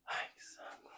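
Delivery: unvoiced, annoyed whisper under the breath; whispered aside of irritation; whispered grumble. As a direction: mutter it to yourself, fed up. Bored reaction to monk's riddle.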